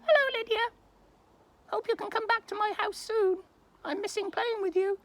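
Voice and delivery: very high, squeaky little owl; owl puppet voice. Pretend. Owl puppet tells Lydia he misses her, high squeak. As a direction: speak up high like a small fond owl. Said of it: "Ossian wants to say hello to you".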